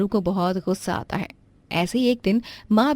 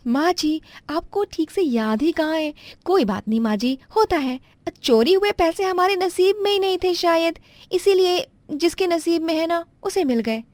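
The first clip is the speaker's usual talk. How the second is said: pitched well up, flustered daughter-in-law apologizing; a higher young wife caught out, stammering; 9 semitones higher than usual. Acted.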